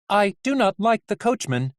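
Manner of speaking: high, evenly stepped character voice; high-pitched matter-of-fact nurse; deadpan Nurse Ratched. Acted. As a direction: state it primly in a high voice. Nurse Ratched, figure in story.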